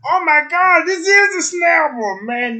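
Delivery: high, loud ecstatic shout; excited scream. Retold or imitated. Man screaming over the red snapper, high and loud. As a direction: shriek with joy at the catch. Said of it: "I'm like".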